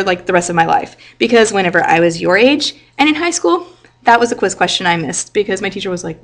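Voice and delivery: ordinary talk, nothing marked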